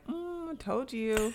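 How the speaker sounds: soft, high, drawn-out told-you-so; mock smug. Imitating Ramirez to his colleague, smug mock gloating. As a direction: gloat quietly, stretching it out playfully.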